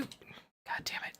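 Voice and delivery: a quick unvoiced swear under the breath; whispered curse. Real, not acted. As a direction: curse to yourself in a whisper, annoyed. Muttered curse mid-story.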